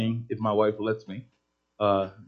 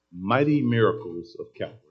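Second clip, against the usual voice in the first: ordinary delivery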